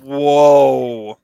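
loud, drawn-out whoa; shocked exclamation; surprised. Real, not acted. Surprised at never seen it.

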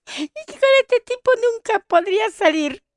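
imitating her young self, high and disgusted; girlish disgust. Girl rejecting the boy with the runny nose, high mock disgust. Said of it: "le dije".

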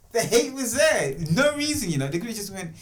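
ordinary talk, nothing marked

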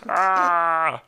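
loud, high comic bawl; Ray Romano. Acted. Doing his favorite impression to annoy wife, loud bawl. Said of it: "is Ray Romano".

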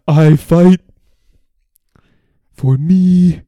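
loud, high-pitched mock roar; screaming imitation. Fan acting out boxer's scream to the crowd, loud imitation. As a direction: belt it out like a boxer defying the crowd. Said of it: "screams it into the crowd".

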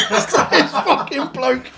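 high-pitched, breaking up with laughter; real helpless giggle while telling it; laughing. Real, not acted. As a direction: squeeze the words out through a laugh. Cracks up at own story.

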